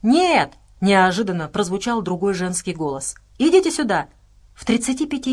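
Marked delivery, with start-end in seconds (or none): 0.0-0.5 high, loud sudden cry; calling out. A narrator's reading. Unknown woman stops the group from behind, calling out. 3.4-4.1 high, loud urgent call. A narrator's reading. Woman by the door summons the group, calling out.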